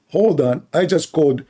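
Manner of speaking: ordinary delivery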